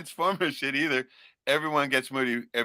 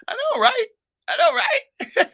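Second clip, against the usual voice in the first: much higher, laughing squeal; mocking the chat in a squeaky voice; 5 dB louder, slower than usual. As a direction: squeak it out giggling.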